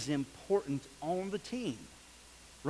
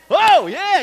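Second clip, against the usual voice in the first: high, loud oh yeah; real thrilled yell; excited yell; 10 semitones higher, 15 dB louder than usual. Real, not acted. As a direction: shout with delight. Catching ball in demo.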